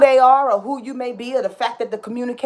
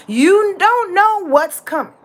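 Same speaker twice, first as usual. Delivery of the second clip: slow, higher, pressing on don't; emphatic; 8 semitones higher, slower than usual. Real, not acted. Warning listeners about coming changes, emphatically. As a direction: drive home "You don't know". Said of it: "I say it so emphatically and so passionately".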